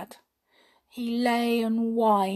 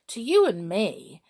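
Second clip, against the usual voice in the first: ordinary delivery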